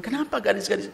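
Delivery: high, whiny grumble of a rejected guy; complaining young man. Retold or imitated. Pastor mimicking a rejected young man's high complaint. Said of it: "oh dia marah-marah, Pak".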